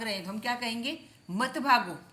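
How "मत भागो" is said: put-on quicker, louder call to children; mock warning. Acted warning to running children. (बच्चों को ज़ोर से टोकते हुए)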